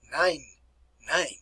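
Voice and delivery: "Nein" rhyming with "nine"; "Nein" demonstrated slowly for learners; German pronunciation. Shown as a demonstration. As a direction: say "Nein" as a model word. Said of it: "can you pronounce this properly?".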